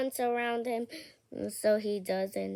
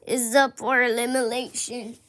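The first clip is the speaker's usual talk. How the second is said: ordinary talk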